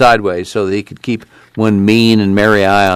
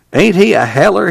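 affectionate grin; 9 semitones higher than usual. A narrator's reading. Tom grins affectionately.

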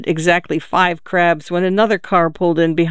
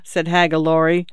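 plain reading voice

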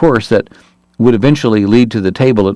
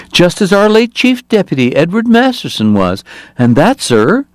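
much higher, smooth and unctuous; oily politician in a piping voice; 9 semitones higher than usual. Acted. Hoover's stump speech.

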